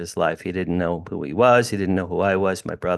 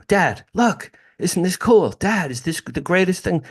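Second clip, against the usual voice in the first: put-on much higher, gushing boyish wonder; a kid bursting with wonder, pitched way up; thrilled kid; 9 semitones higher than usual. Boy showing his father the UFO, high and thrilled.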